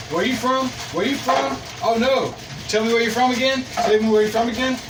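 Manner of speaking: high, repetitive mock questioning; mock spamming. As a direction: rattle off the questions like spammed texts. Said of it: "I just spam texted her that shit".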